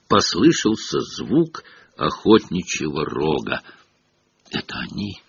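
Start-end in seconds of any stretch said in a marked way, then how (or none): 4.5-5.2 much softer, bitter murmur. A narrator's reading. Nick bitterly announcing the hunt's arrival, soft. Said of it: "с горечью вымолвил".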